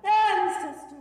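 high, loud cry out to ancestors; high calling cry. Acted. Patient crying out to ancestors, high, loud, slow. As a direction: call out to the ancestors.

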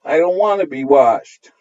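put-on high, loud little boy aping his sister; clumsy imitation. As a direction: copy the sister's tantrum clumsily. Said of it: "clumsily imitating his sister".

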